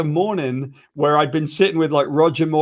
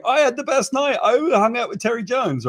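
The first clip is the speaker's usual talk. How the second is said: mock smug boast; 7 semitones higher than usual. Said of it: "you were just like".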